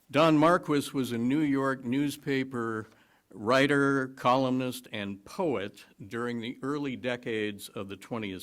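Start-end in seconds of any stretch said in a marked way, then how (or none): none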